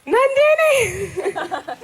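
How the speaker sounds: high, slow laughing call of her name. Real, not acted. Calling out to a missing classmate, laughing.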